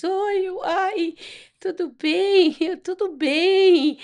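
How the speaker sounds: high, loud, caught-off-guard greeting breaking into a nervous laugh; flustered startled greeting. Retold or imitated. Caught sneaking in by the church lady, a high flustered hello with a nervous laugh. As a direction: squeak out a surprised hello when you're caught red-handed.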